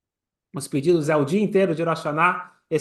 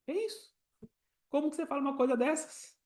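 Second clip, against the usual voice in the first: high, softer voice of disbelief; incredulous reproach; 8 semitones higher, 10 dB quieter than usual. Acted. Preacher reacting to the employee's cheeky demand, incredulous. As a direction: scold softly in high-pitched disbelief.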